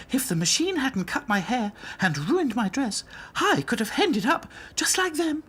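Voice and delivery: high, animated doll voice; Princess's voice. A narrator's reading. Princess reflecting on her narrow escape, animated.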